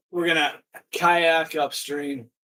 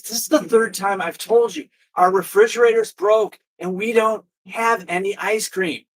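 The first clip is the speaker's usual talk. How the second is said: losing patience with the customer; exasperated worker; 5 semitones higher than usual. Acted. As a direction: clerk repeating himself for the third time, exasperated.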